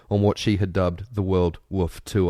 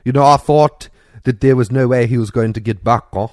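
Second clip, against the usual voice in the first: loud South African accent, hammed up; a rough attempt at South African; 2 semitones higher, 8 dB louder than usual. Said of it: "can I do a South African accent".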